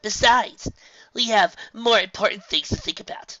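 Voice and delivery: loud, high cheerful reassurance; upbeat filly. Acted. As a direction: cheer up your friends.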